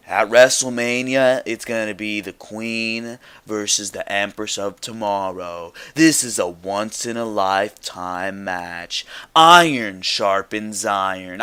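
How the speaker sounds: slow, low scripted promo read; forced promo recital. Retold or imitated. Host mimicking Charlotte's scripted promo, slow forced recital. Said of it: "forced W W E verbiage".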